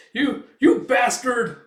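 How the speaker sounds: high, loud, spluttering insult; outraged little sidekick. Retold or imitated. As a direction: shout slowly after the one who insulted you. Insulted sidekick shouts after him.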